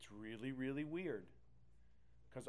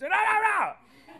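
much higher, louder, slow mock wail; hammed-up wail; 22 dB louder, slower than usual. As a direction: ham up a dramatic cry. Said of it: "small voice".

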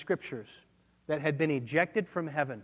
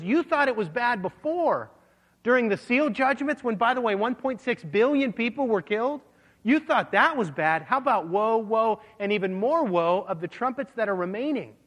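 high, pressing warning to the listeners; rising warning; 6 semitones higher, 3 dB louder than usual. Acted. Eagle warning earth's dwellers, pressing and raised. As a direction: warn them it gets worse.

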